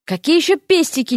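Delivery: mildly exasperated, pitch jumping up. A narrator's reading. Vasya losing patience with Derek's hints, exasperated. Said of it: "не выдержала Вася".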